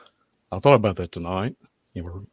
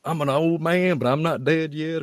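put-on tough old man; 7 semitones higher than usual. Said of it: "he'd always put a tough act on".

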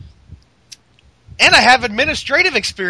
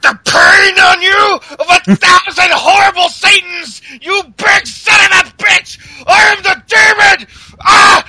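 higher, louder, yelling menace; hammed-up demon rage; 5 semitones higher, 3 dB louder than usual. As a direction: explode like a cartoon demon boasting. Kane's demon outburst in retelling.

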